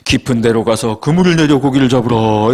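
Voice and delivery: low, measured scriptural command; acted-out solemn instruction; Jesus. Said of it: "사 절에서 예수님이".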